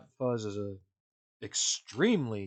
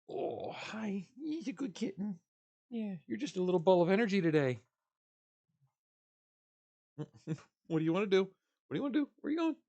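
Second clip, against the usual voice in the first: pet voice; 7 semitones higher than usual. Real, not acted. Talking to kitten.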